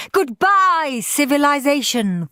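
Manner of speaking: gleeful farewell. A narrator's reading. Henry gleefully saying goodbye to home. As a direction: wave off the world with glee. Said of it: "thought Henry".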